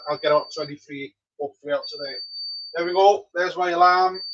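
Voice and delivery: usual speaking voice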